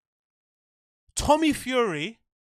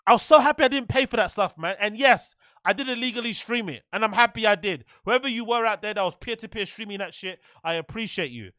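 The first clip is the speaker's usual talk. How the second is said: normal talking voice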